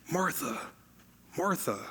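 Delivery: softly spoken name, said twice with care; gentle, tender. Acted. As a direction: call her name gently, like a loving friend. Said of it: "her name gently twice".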